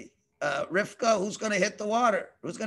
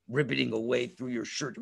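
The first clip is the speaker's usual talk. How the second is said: ordinary delivery, nothing marked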